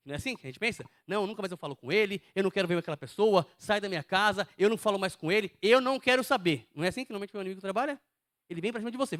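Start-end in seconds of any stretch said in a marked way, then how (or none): 1.1-6.7 loud, emphatic outburst building in force; hurt refusal. Acted. Hurt believer refusing all contact with the offender, angry and loud. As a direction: play someone angrily cutting a person off.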